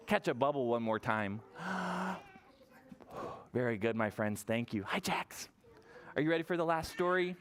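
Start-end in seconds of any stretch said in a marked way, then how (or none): 1.5-2.2 big soft breath in; demonstrative gasp. Shown as a demonstration. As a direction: gulp air as if catching a bubble. Shows toddlers catching a bubble.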